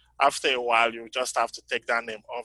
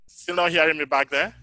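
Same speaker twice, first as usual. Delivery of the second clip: raised voice, louder and higher; calling to the back; 4 semitones higher, 7 dB louder than usual. Real, not acted. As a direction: call out to check the back row can hear. Checking the back can hear.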